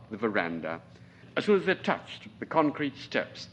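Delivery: plain reading voice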